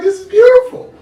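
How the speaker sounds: high, loud mock delight. Watching Wakanda, ironic delight at the plot's message. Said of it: "I was like".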